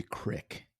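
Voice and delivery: "crick" said with a short i, for creek; hillbilly crick. Acted. Co-host mocks how creek gets said, hillbilly crick. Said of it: "How is C R E E K crick".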